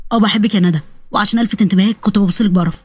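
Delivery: low male character voice; young man confessing. Acted. (شاب بيعترف بحبه بصوت واطي)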